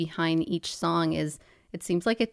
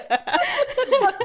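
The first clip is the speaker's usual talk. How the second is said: high, loud laugh breaking up; bursting into a laugh; burst of laughter; 6 dB louder than usual. Real, not acted. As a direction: crack up at the failed try. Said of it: "breaks up laughing".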